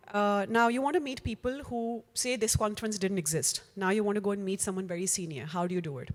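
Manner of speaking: normal talking voice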